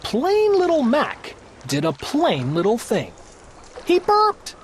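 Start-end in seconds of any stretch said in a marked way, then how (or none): 3.9-4.6 mock burp. A narrator's reading. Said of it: "his burp shook the throne".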